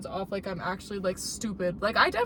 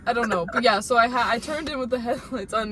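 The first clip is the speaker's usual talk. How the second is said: ordinary talk, nothing marked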